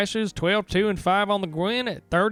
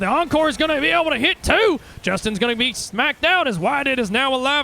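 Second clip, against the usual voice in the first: excited; 9 semitones higher, 5 dB louder than usual. Acted. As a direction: pitch jumps up, louder, hyped. Climax of the teamfight call.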